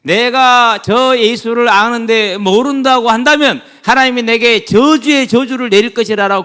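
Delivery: loud, high, slow swearing; hammed-up oath of denial in a preaching voice; vehement oath. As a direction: swear it out emphatically, word by word. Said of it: "그렇게 맹세하면서 모른다고 합니다".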